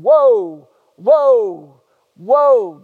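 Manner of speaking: woe, woe, woe punched loud each time; stressed woe. Shown as a demonstration. Preacher hammering the little word woe to the church, loud. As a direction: hit every woe hard and loud. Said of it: "he kept using that little word".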